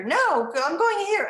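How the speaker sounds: high, loud imitated child refusal; defiant child. Boy refuses teacher's other table, imitated loud defiance. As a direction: dig in like a stubborn little boy.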